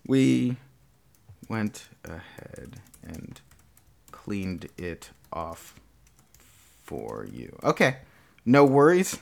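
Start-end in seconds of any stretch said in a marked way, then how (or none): 0.1-7.6 very quiet, halting self-dictation; slow, soft, word-by-word drafting. Real, not acted. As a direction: murmur it piece by piece as you type. Typing out the agreed line.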